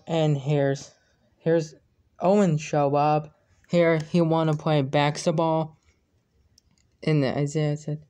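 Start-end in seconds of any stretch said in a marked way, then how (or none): none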